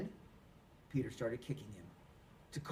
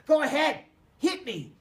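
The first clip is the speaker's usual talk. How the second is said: loud high furious taunt; yelling go ahead hit me; taunting shout; 11 semitones higher, 10 dB louder than usual. A narrator's reading. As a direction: goad him at the top of your voice. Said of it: "he taunted".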